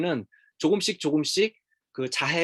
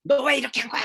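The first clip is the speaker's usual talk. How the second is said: high, louder mock scolding; hammed-up angry demand, pitched way up; angry outburst; 11 semitones higher, 4 dB louder than usual. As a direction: act out lashing out at a child. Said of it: "남편한테 막 화내고".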